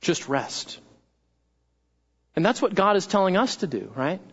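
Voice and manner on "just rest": soft, hushed and low; soothing. Acted. Mother quietly tells her crying child to rest, soft and low. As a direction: settle him gently. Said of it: "what does mom do?".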